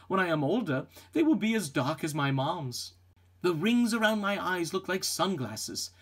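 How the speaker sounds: usual speaking voice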